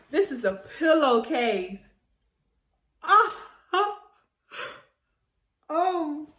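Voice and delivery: laughing through the words, delighted; loud delighted laugh. Real, not acted. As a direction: burst out laughing at the gift. Opening a care package gift.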